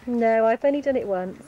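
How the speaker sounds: loud call across the field. Real, not acted. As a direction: shout it to someone far away.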